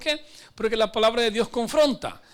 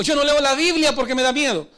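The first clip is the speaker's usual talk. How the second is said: mock fearful excuse; 4 semitones higher, 6 dB louder than usual. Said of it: "Otros dicen así".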